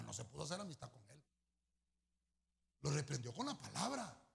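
ordinary talk, nothing marked

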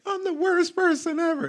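put-on very high, whiny, falling-apart little-girl voice; mock daughter meltdown. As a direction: wail it like a child who just lost a game. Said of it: "she's falling apart and being like".

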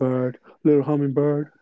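slack-jawed dopey hero voice. Retold or imitated. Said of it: "puts such a dopey voice".

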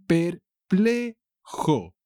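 "perplejo" high and very slow: per, ple, jo; "perplejo" drawn out high in three beats; syllable by syllable. Shown as a demonstration. Teacher stretches word in example.

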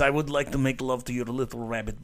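hammed-up Russian guy accent, laughing through it. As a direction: lay on a joking Russian accent. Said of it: "I can do a pretty good Russian guy".

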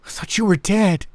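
high-pitched anxious voice; worried. A narrator's reading. Harriet checking the narrator for wounds, high worried voice. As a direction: say it high, shaken by worry.

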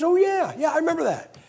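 high-pitched, dawning "oh yeah"; delighted recognition. Retold or imitated. Missionary recognizing a man he once helped, delighted recognition.

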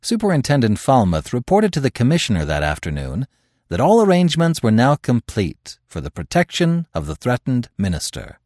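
usual speaking voice

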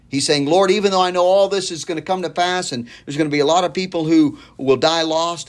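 high, earnest preaching voice; fervent preaching. Acted. Preacher voicing John's longing prayer, high and earnest. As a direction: preach it with rising fervor.

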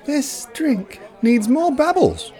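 fussy meter maid chirping at her soda. Acted. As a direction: chirp it like a picky little bird. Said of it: "she chirped".

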